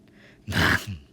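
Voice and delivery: short, deep dragon roar; a loud, low burst of dragon growl. Shown as a demonstration. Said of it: "like that".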